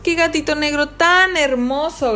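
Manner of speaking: high, drawn-out admiration; girls' delighted cry. A narrator's reading. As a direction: gush slowly over an adorable kitten. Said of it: "gritaron todas las niñas".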